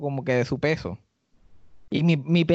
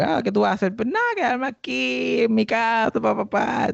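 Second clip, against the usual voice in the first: innocent fib; 7 semitones higher than usual. Retold or imitated. Retelling lying texts to a date.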